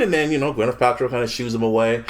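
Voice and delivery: usual speaking voice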